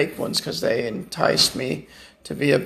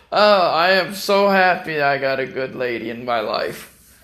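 normal talking voice

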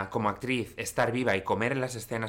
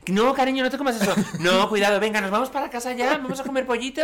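high sing-song talk to a pet; voice for talking to dog; 12 semitones higher, 6 dB louder than usual. Real, not acted. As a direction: coo at your dog, much higher. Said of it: "¿Tienes voz de perro? Para hablarle a tu perro".

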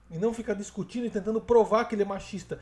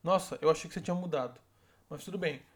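soft and resigned, fading lower and quieter; calm, quiet letdown; 4 semitones lower, 6 dB quieter than usual. Shown as a demonstration. Woman quietly telling her partner she's disappointed, calm and soft. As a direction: say it quietly, disappointed, without arguing. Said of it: "Você simplesmente fala assim, ó".

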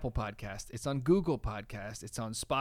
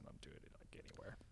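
whisper; 11 semitones lower, 22 dB quieter than usual. Real, not acted. Trailing whispered aside.

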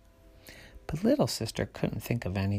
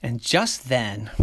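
plain reading voice

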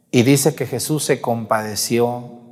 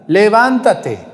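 levántate drawn out, higher and louder, slow; commanding call; 7 semitones higher, 4 dB louder, much slower than usual. Acted. Jesus ordering the dead youth to rise, booming. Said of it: "golpeó el ataúd".

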